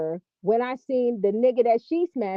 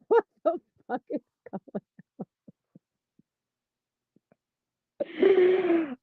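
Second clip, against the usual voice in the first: cracking up; 6 semitones higher, 4 dB quieter, much faster than usual. Real, not acted. Laughing too hard to speak.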